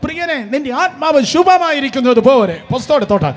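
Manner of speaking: loud, high preaching at full force; ringing pulpit proclamation; fiery preaching. Acted.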